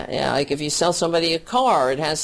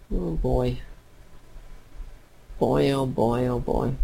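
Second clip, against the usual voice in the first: composing herself; 3 semitones lower, 4 dB quieter than usual. Real, not acted. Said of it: "I need to get a grip".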